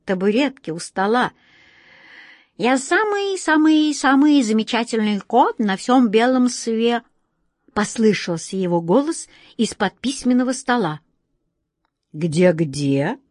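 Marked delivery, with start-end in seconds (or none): 2.6-7.0 higher, bragging cat; boastful cat voice. A narrator's reading. Cat boasts from under the desk, higher bragging voice. 12.1-13.2 stern question. A narrator's reading. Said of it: "строго спросил".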